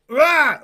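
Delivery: sudden loud "rah" of mock fury; mock raging roar. Host mimicking Alex going off on the man, mock raging roar. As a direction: burst out in a cartoon roar. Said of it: "goes off on him".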